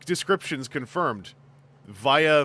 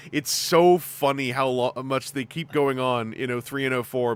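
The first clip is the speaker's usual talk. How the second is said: ordinary talk, nothing marked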